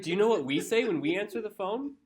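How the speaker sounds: high, loud mock-incredulous comeback; exasperated retort. Retelling a comeback to a partner about phone greetings, mock exasperation.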